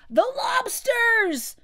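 high, loud, drawn-out shout; excited shout. A narrator's reading. Griffin breaks in on the Mock Turtle, excited shout. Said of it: "shouted the Griffin".

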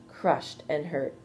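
plain reading voice

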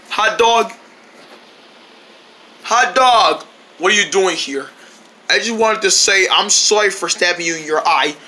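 normal talking voice

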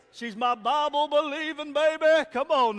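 loud, high proud boast; excited. Real, not acted. Preacher bragging on his wife, loud and high. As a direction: belt it out about your wife.

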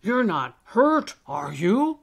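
loud, hearty concern; concerned old elf. Acted. Old elf asks the hidden Alistair if hurt, concerned. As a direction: check on the little one loudly.

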